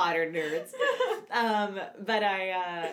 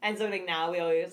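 usual speaking voice

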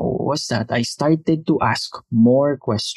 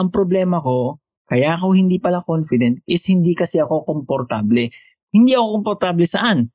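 ordinary talk, nothing marked

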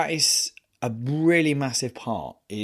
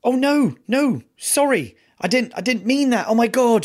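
high, louder flustered apology; flustered nurse; 12 semitones higher, 5 dB louder than usual. Retold or imitated. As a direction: imitate the nurse scrambling to take it back. Nurse panics apologising in story.

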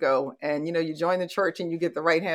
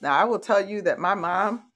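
normal talking voice

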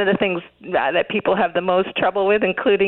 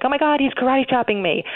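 mock alarm; 7 semitones higher, faster than usual. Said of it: "already, like, getting defensive".

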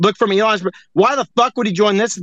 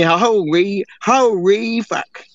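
holy fuck dragged out very slowly, holy long and falling; slow drawn-out imitation; much slower than usual. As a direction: stretch every syllable of holy fuck. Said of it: "I've been hanging around Machi too long".

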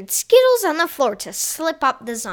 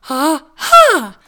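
triumphant ha ha; 3 semitones higher, 11 dB louder than usual. Acted. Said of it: "said Donut".

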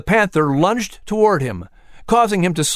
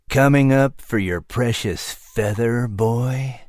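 low, menacing big-cat voice, lightly growled; growling panther; 4 semitones lower, slower than usual. A narrator's reading. Panther taunting the boy in the tree, low growl. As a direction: taunt the boy like a lurking predator. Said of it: "the panther growled".

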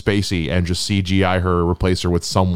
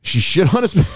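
incredulous echo; 12 semitones higher than usual. Real, not acted. Echoing in disbelief.

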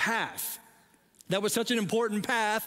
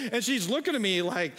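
ordinary talk

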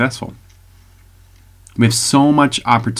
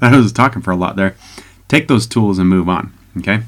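normal talking voice

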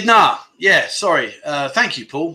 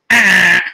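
loud imitated wrong-answer buzzer; game-show buzzer; 7 dB louder than usual. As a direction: blare out a harsh buzzer noise. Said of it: "our survey says".

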